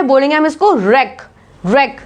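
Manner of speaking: रेक with a plain R; silent W. Shown as a demonstration. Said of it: "W silent है".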